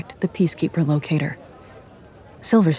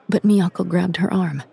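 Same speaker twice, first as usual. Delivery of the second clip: plain reading voice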